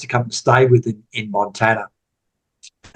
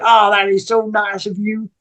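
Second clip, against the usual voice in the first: higher, mock sweet American accent of the waitress; imitating a gushing American waitress, pitched up; 9 semitones higher than usual. Waitress touched by the compliment on his mum, higher mock American accent. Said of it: "sorry about the accent".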